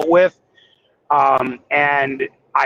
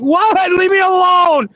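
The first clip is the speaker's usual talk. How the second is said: high, loud hammed-up brush-off; mock protest; 4 dB louder than usual. Trucker shooing off the hollering cookies, high mock protest. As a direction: playfully shoo away the temptation.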